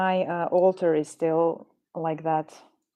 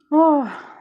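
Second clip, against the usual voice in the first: surprised; 9 semitones higher, 7 dB louder than usual. Real, not acted. Startled by flies.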